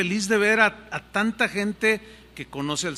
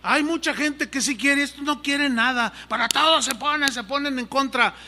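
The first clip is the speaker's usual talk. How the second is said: raised, higher frustrated outburst; fed up and ready to quit, voice pitched up; angry complaint to wife; 8 semitones higher, 4 dB louder than usual. Retold or imitated. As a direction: pastor telling wife he is leaving, angrily. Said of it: "Yo estaba enojado".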